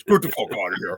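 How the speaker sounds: gruff mock impression of a friend; Quinn voice. Mimicking Quinn dismissing his retirement. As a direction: do your buddy Quinn telling you to quit it. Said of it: "I'm gonna do my Quinn voice".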